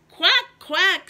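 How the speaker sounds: high, loud quacking; duck quack. Acted. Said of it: "said the duck".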